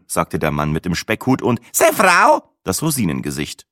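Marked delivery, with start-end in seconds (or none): 1.7-2.4 much higher pitched, louder woman's voice; raised voice for the old woman. Acted. The old wife introduced by her husband, higher voiced. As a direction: pop it out high.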